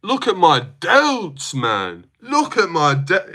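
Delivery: rough attempt at a UK accent; MMA guru. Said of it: "but a guru impression?".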